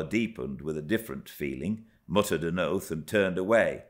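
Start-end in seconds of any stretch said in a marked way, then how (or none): none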